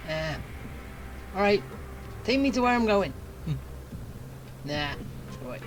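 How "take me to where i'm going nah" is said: usual speaking voice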